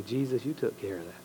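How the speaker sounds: preacher voicing a soft, humble prayer; quiet prayer. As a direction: pray it gently, almost to yourself.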